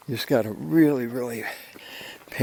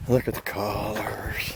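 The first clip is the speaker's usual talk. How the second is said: whispered awe; slower than usual. Real, not acted. Awed by view on hike.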